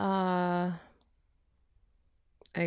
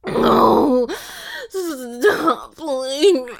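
crying; 5 semitones higher, 4 dB louder, much slower than usual. Acted. Crying pleading.

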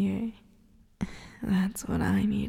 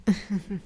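wicked laugh. Acted. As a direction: laugh at the trapped listener. Gloating over a bound victim.

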